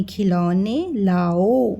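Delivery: put-on slow, drawn-out reading for children; slow reading aloud. Shown as a demonstration. Teacher reading a phonics line slowly to kids. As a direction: read खिलौने लाओ slowly, word by word. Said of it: "लौ और ने".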